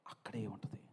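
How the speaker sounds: hushed whispered answer; breathy conspiratorial whisper. Acted. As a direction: whisper it like a secret. Preacher answers his own question.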